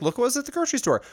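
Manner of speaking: high, fast excited voice; mocking excited shopper. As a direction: gush about a grocery find. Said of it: "people be like".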